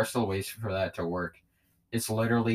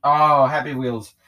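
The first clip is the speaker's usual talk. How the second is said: excited; 5 semitones higher, 11 dB louder, slower than usual. Real, not acted. Excited recognition of favorite game.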